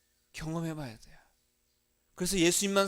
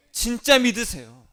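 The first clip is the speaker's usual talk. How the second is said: "진짜" shouted higher and louder, slow and firm; emphatic command; 5 semitones higher, 12 dB louder than usual. Real, not acted. Pastor demanding real faith, "진짜" shouted slowly. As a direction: hammer "진짜" home as an order.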